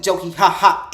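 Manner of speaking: scornful ha ha; mock laughter. Real, not acted. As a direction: sneer a laugh at him. Mocking the clerk.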